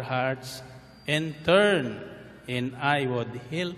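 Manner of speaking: slow, measured reading of scripture. Acted. Pastor reads Bible verse to congregation, slow solemn reading. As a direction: read each phrase slowly and solemnly.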